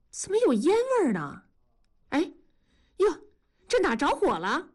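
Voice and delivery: high-pitched suspicious question turning to alarm; surprised alarm. A narrator's reading. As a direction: sniff out trouble in a high wife's voice. Wife smells smoke, spots fire.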